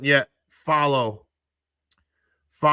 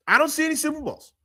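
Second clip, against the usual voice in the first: repeated higher, with a pointed sarcastic edge; mocking disbelief; 12 semitones higher, faster than usual. Real, not acted. Streamer mocking a quarterback's missing Super Bowls, sarcastic and high. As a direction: needle them by saying it again way up high.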